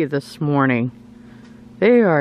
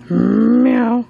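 imitated meow; 5 semitones higher, 3 dB louder than usual. Meowing at the cat.

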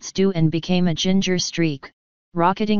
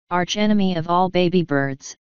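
usual speaking voice

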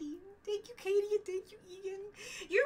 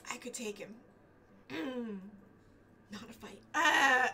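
ordinary talk

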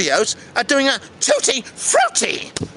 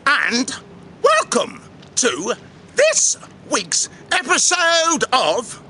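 slow, drawn-out welcome with sudden high notes; theatrical host intro; 6 semitones higher, much slower than usual. Acted. Host welcomes viewers to the channel, theatrical intro. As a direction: stretch out a grand show opening.

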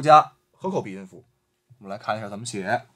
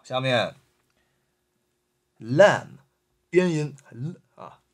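ordinary delivery

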